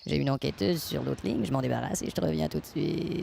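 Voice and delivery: deep, warped voice like a frog; voice changer. Acted. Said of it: "ta voix sonne comme une grenouille".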